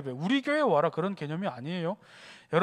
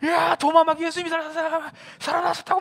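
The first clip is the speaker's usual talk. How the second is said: high, loud, stammering shout; frantic acted babbling; crazed excitement; 8 semitones higher, 4 dB louder than usual. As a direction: yell the news while rushing at your friend. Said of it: "미친놈처럼 달려들어와가지고".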